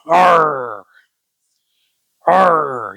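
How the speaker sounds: loud playful pirate growl. Shown as a demonstration. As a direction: give a hearty pirate arr. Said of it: "I sound like a pirate now".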